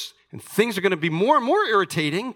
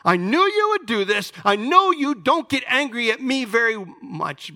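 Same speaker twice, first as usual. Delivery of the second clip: high-pitched acted complaint; indignant prophet, pitched up and pushed hard; hopping mad; 8 semitones higher, 4 dB louder than usual. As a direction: accuse God in mock exasperation. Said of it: "This is a guy who's hopping mad".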